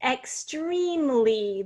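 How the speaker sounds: "Extremely" drawn out slowly in three parts, ex, treme, lee; "Extremely" stretched, with a long E in the middle; syllable by syllable. Shown as a demonstration. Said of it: "there's three syllables".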